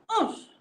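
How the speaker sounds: high, loud jumping grunt; effort grunt. Acted. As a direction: push off with a grunt. Croc leaps over the rock.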